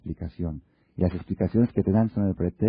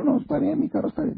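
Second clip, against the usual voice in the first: very high, squeaky wife voice; tearful wife. Retold or imitated. Wife refusing husband's offer of a car, high and teary. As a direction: pipe up like a weepy wife.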